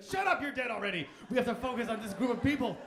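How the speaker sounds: mock impatient snap; elder crowd shouting down the old; dismissive pundit.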